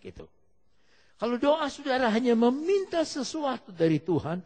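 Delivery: high, loud, emphatic exhortation; forceful preaching. Real, not acted. Preacher warns against prayer that only asks, full force. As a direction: drive the point home to the congregation.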